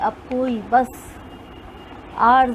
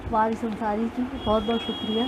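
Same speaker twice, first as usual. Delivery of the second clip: normal talking voice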